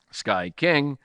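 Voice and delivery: ordinary talk, nothing marked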